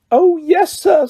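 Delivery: high, eager, earnest house elf; mean every word, wide-eyed. A narrator's reading. Elf earnestly answering Harry, high and eager. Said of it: "said Dobby earnestly".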